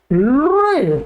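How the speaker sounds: लृ as l running into a rolled r; vocalic l pronunciation. Shown as a demonstration. Teacher showing the Sanskrit sound, drawn-out लृ. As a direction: draw लृ out with a rising then falling glide. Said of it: "इसका उच्चारण होता है".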